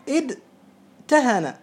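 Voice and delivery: ادتهن drawn out very slowly, each sound separated: اد-ت-هن; slow demo of the original form. Shown as a demonstration. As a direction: spell out ادتهن slowly so every letter is heard, the تاء clear. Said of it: "هذا الأصل". (نطق بطيء جدا لكلمة ادتهن مع إظهار التاء)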